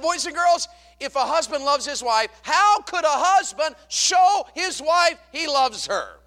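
high, loud, slow preacher's shout; big ringing call to a crowd of kids; shouted question to children. Retold or imitated. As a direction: holler it slowly, stretching the key words.

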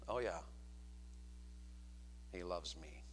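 very low, soft deadpan with a long pause; mock-unenthusiastic murmur; doubtful wife's reply. Shown as a demonstration. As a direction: drop it low and quiet, unconvinced. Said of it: "would she say it like this".

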